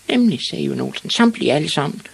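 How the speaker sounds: put-on high, loud boyish glee; proud confirmation. Ivan confirms his huge wish to the witch, triumphant.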